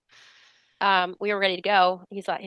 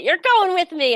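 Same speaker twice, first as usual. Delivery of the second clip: loud, high, triumphant insistence; excited announcement; 11 semitones higher, 5 dB louder than usual. Retold or imitated. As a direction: burst out with it, pitch leaping up. Emphatic repeat of news to husband.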